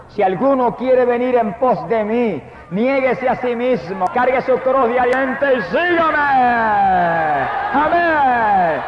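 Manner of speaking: high, slow solemn preaching; drawn-out high pulpit proclamation; preaching scripture. Acted. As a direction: lift the verse up slowly to the congregation.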